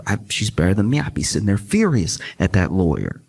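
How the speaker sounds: ordinary talk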